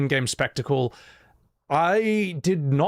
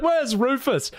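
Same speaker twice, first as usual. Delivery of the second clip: mock Sid asking; 10 semitones higher, slower than usual. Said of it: "He's like".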